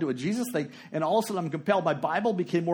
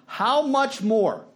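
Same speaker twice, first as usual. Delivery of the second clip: "How much more" high, long and weighty; emphatic quotation; 7 semitones higher, 4 dB louder, much slower than usual. Acted. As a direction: stretch out "How much more" very slowly, raised pitch. Dramatises the verse's key phrase.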